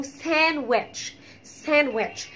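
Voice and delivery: "ساندويتش" as SAN-wetch, no d; silent d. Shown as a demonstration. Teacher showing the rule, "ساندويتش" with silent d. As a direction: model "ساندويتش" dropping the d completely. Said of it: "انت بتشيل الدي خالص".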